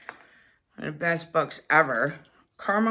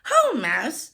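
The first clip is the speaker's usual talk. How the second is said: hare greeting; 4 semitones higher, 4 dB louder than usual. A narrator's reading. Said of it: "says Hare".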